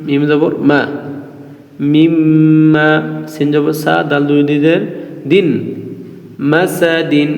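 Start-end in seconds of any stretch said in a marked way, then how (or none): 1.8-2.9 মিম্মা slow and sung, the double m held with a hum; মিম্মা drawn out with nasal hold; doubled mim. Shown as a demonstration. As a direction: lean on the doubled মিম in মিম্মা. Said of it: "মিম মিমজের মিম".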